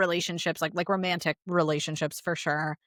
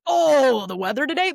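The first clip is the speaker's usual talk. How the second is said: delighted small talk; 6 semitones higher, 7 dB louder than usual. Retold or imitated. Therapist acting out genuine weather chat, loudly delighted. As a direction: gush about the weather, imitating real delight.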